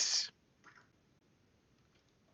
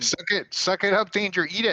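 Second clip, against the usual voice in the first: taunting; 7 semitones higher, 5 dB louder than usual. Real, not acted. Taunting a teammate during combat.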